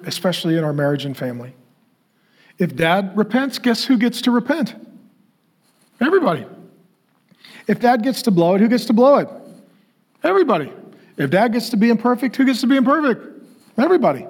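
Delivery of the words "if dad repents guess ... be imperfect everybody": higher, rising questions, punched answers; call-and-response sermon cadence; preaching build. Acted. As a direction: drive the point home to the congregation.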